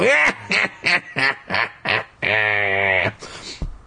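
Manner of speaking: a sly villain's cackle from far back; evil cackle. Acted. The gruel gloats at the narrator with an evil cackle. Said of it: "let out an evil crackle".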